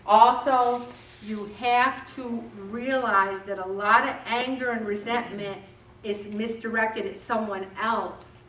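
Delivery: ordinary delivery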